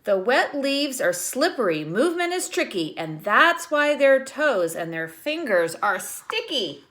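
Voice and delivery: lightly lilting read-aloud; reading a picture book to children. Acted. Teacher reading the book to her class, lightly lilting.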